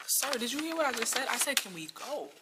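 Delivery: loud, high-pitched retold outburst; retold exasperated demand. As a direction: repeat yourself loudly, fed up, as in a retold argument. Retold demand to leave the date.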